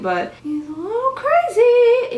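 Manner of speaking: "but he's a little crazy" in a very high, squeaky voice; playful high-pitched gush; mock squeal. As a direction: squeal it like a giddy fangirl. Mock swooning over new vampire.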